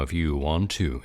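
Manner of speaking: a hint of the professor, low "want"; lightly voiced stern professor; imitating professor. Host quoting his college professor, lightly voiced. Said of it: "much deeper voice".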